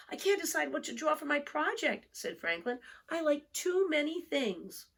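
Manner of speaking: put-on an undecided young turtle explaining; Franklin's child voice. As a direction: let it rise, a bit worried.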